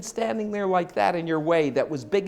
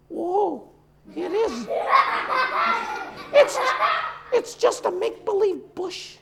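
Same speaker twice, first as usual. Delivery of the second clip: relieved horse voice; 11 semitones higher than usual. Acted. Horse realizes bush, relieved.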